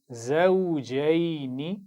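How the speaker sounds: drawn-out, melodic tajweed recitation; slow Quran recitation. Shown as a demonstration. Teacher reciting slowly for pupils to repeat. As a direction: chant each syllable slowly for the class to copy. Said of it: "एक दफ़ा फिर पढ़ेंगे".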